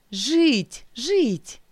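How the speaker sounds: high, hysterical howl of relief. Acted. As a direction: wail it out, half-mad with relief. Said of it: "выла я в полном неадеквате".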